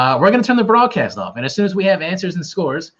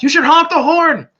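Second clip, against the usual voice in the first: retold shout from inside, high and loud; dad shouting; 14 semitones higher, 6 dB louder than usual. Dad yelling from inside the house, imitated shout. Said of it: "my dad shouted from inside the house".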